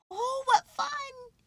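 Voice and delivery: very high, stretched-out glee; smiling delight. A narrator's reading. Bear slides on soap flakes, smiling delight. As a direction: squeal it slowly with a smile. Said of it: "he said with a smile".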